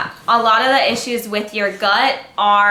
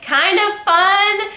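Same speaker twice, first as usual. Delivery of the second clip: playful; 11 semitones higher, 4 dB louder, much slower than usual. Real, not acted. Playful stretch on fun.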